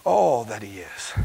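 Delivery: quiet, awed repetition; hushed awe. Real, not acted. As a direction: let it fall to a reverent hush. Preacher drops to hush.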